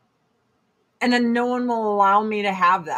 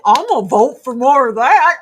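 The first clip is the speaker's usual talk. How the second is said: mock voter; 2 semitones higher, 9 dB louder than usual. Mocking a voter's logic.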